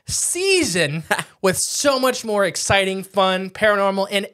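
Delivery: loud, high, slow grand farewell; hyped sign-off. Acted. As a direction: sell it like a showman.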